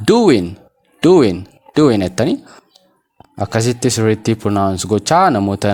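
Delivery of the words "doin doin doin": DOING repeated as "doo-win", each falling; silent G. Shown as a demonstration. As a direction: drill doing with no final g. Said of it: "G jedhiinaa ishee kana". ("doing" gaa malee, "duwin")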